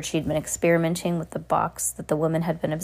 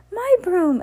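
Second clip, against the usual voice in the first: high, loud echo of dismay; echoed cry; 13 semitones higher, 5 dB louder than usual. A narrator's reading. Mary echoing her sister's cry, high and loud. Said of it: "echoed Mary".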